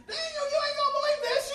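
loud, excited mock falsetto; high-pitched voice. Preacher imitating Sandy running back with news, high excited voice. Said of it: "had a real high-pitched voice".

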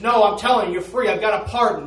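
loud, high, pleading insistence; insistent shout. Retold or imitated. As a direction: convince him, raising your voice. Messenger insists, loudly.

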